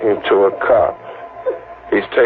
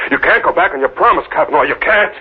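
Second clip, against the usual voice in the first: high, rising panic; frantic pleading; 7 semitones higher than usual. Acted. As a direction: plead desperately against a trick. Desperate man accuses Kavanaugh.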